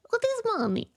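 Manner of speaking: high put-on Russian mom accent, fast; imitating Russian mom accent. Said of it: "And she'd be like".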